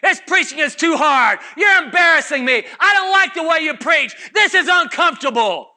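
loud, high whiny protest; complaining disciples. Retold or imitated. Disciples griping to Jesus, loud and whiny. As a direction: whine loudly about the preaching.

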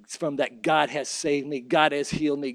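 normal talking voice

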